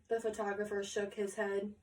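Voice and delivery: ordinary delivery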